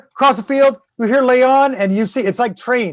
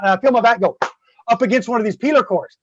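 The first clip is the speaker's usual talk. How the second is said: ordinary talk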